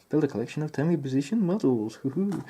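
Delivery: a hint of a high, mock announcer voice with a hoo-hoo; odd put-on voice. Reading the model box aloud in a lightly silly put-on voice. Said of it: "I don't know why I said it like that".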